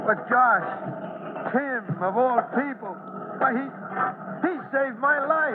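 slow, high, halting grief; a touch of breaking voice for a trapped friend; choked up. Acted. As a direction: hold back tears as you say it. Fireman distraught over buried friend.

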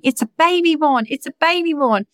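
put-on high, thrilled child's squeal of delight; excited little sister. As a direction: imitate a three-year-old ecstatic over her present. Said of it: "she's going".

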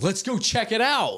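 high, eager mock bravado; clueless horror victim. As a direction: chirp it like a doomed movie character.

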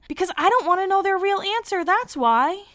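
high, emphatic protest at unwanted advice; exasperated retort. Real, not acted. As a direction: push back in high-pitched frustration. Retorts to advice she hates.

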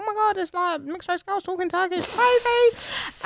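high, amazed mock voice; surprised shop woman. Turkish shop woman amazed at mixed race girls, mock gush. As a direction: gush like a stunned shopper. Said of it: "This woman's like".

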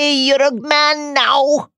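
slow hammed-up cheer; drawn-out mock proud cheer; mock celebration.

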